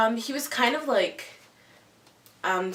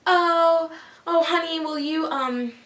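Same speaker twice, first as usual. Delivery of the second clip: sugary, higher mock sweetness; gushing sweet talk; 7 semitones higher than usual. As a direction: imitate a crush's sweet favor-asking, pitched up. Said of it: "say these really nice things".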